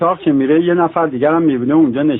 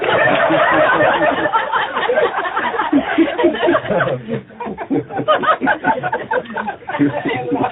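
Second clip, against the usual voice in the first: long high burst of laughing; long laughter; 3 dB quieter than usual. Real, not acted. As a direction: laugh at length.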